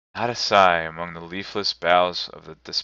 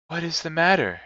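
high, gentle-level ghost voice; spirit's voice; 9 semitones higher than usual. A narrator's reading. The spirit questioning Scrooge, in a high voice. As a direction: ask in a raised, otherworldly pitch.

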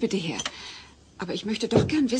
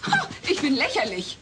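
higher, offended scoff; indignant retort; 7 semitones higher than usual. Acted. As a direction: throw his word back, stung. Kate empört sich im Streit. (empört, höher)

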